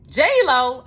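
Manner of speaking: high, loud disbelieving echo; surprised. Retold or imitated. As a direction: repeat the name in shock. Hearing her own name.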